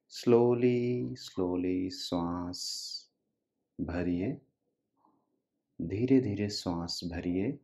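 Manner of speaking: drawn-out, soft words stretched to pace the breath; soft, slow breathing guidance; slow breathing cue. Real, not acted. Pacing slow breathing for listeners.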